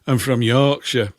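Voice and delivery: slow, imitated Yorkshire accent; put-on Yorkshire voice. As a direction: do a Yorkshireman, drawn out. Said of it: "where's that accent from".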